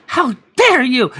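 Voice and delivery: high, loud indignant cry; an imitated offended shout. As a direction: fire it back, insulted. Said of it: "And she's like".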